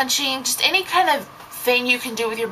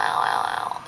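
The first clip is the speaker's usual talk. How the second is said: whispered mock ow; 4 dB quieter than usual. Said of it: "I'm like".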